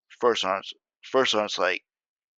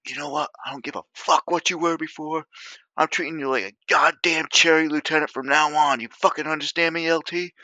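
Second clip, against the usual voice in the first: chewing out the lieutenant at a higher, louder pitch; retold angry yelling; 7 semitones higher, 4 dB louder than usual. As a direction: tear into him like a furious platoon sergeant. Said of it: "screaming at each other".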